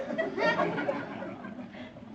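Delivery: high breathy giggle; giddy giggle. Acted. Pleased with his new preaching voice: high breathy giggle. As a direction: giggle in delight.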